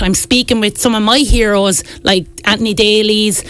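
ordinary talk, nothing marked

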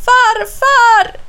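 very high, drawn-out loud cry; desperate shout for grandfather; calling out. A narrator's reading. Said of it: "ropar han". (förtvivlat, utdraget rop)

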